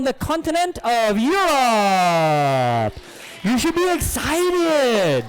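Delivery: showman's slow, stretched-out big reveal; hyped announcement. Acted. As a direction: draw out EUROPE and EXCITED to whip up the crowd.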